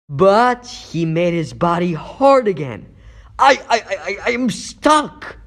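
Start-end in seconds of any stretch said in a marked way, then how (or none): 3.4-5.4 high, straining stutter; alarmed stammer. Acted. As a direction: stammer in panic as you struggle to pull free. Hero trapped in villain's hardened body.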